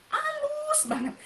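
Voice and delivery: very high, stretched "halus"; mock an over-refined manner; sing-song, drawn-out high daintiness; exaggeratedly dainty.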